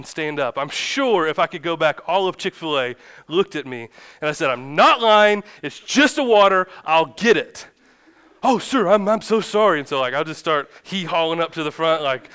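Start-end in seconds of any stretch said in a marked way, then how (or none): 4.5-7.7 loud, higher-pitched retold protest; exasperated outburst. Dad retelling how he stood up at Chick-fil-A, exasperated outburst. 8.4-9.8 loud, high, stammered apology; imitating flustered apology. Old gentleman at Chick-fil-A apologizes to angry customer, imitated fluster.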